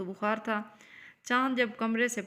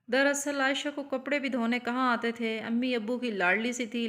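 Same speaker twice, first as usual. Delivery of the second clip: plain reading voice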